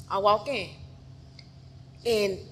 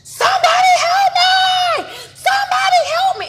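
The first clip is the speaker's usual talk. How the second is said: full-force, high-pitched cry for help; screaming for help; 13 dB louder than usual. Retold or imitated. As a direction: scream so the neighbors hear. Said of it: "And I'm screaming".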